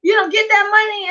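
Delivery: high, breaking with excitement; excited husband. Retold or imitated. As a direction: urge her on, thrilled and squealing. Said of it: "he was like".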